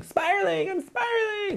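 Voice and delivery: jumps very high, falling away; mock panic, voice sliding down; spiraling. Shown as a demonstration. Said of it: "That's what happens".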